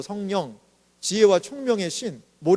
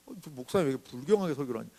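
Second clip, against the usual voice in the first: quick, softer grumbling aside; muttered complaint; 7 semitones lower, 10 dB quieter, faster than usual. Retold or imitated. Listener muttering about the irreverent pastor, quick grumble. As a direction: grumble under your breath at the pastor. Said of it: "이렇게 얘기하면 어때요?".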